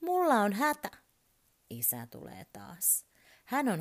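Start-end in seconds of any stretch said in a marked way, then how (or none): 0.0-0.9 put-on high, loud urgent little boy; child calling out. A narrator's reading. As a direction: shout for help from bed. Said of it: "hän huutaa".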